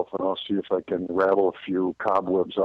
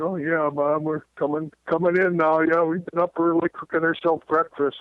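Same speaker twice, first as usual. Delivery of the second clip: put-on higher, sweet kid fibbing to mom; innocent kid voice; 9 semitones higher than usual. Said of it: "they say".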